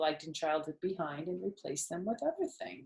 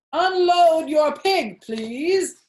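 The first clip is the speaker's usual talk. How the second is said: loud, slow, booming higher announcer; grand loudspeaker order; pompous announcer; 10 semitones higher, 11 dB louder, much slower than usual. A narrator's reading. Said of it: "said the loudspeaker".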